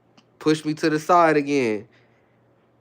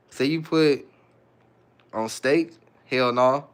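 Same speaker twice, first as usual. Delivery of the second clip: ordinary talk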